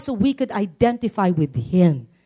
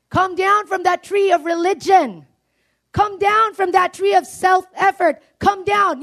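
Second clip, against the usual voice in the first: calling down; 7 semitones higher, 4 dB louder than usual. Acted. Jesus calls Zacchaeus down, repeatedly.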